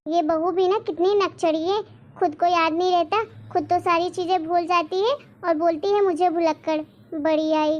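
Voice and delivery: ordinary delivery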